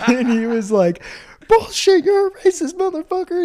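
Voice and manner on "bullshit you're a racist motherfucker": very high, loud mock screaming; imitated furious instructor. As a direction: explode at the recruit like a drill instructor. Instructor exploding at recruit.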